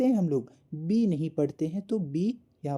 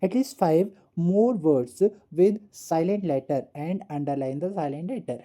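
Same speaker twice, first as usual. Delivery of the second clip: slow read-out; much slower than usual. Shown as a demonstration. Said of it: "teacher reads the task slowly".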